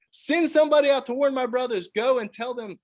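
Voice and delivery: high, loud desperate begging from torment; desperate plea. Retold or imitated. Rich man in torment begging Lazarus, high loud plea. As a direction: cry out to be heard from far below. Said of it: "crying out to his brothers".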